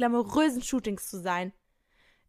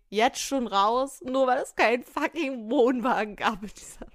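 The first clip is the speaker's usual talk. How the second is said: laughing disbelief. Real, not acted. Mocking the diva.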